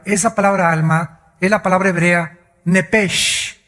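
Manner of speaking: ordinary talk, nothing marked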